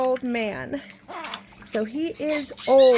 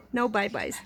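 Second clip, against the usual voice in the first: ordinary delivery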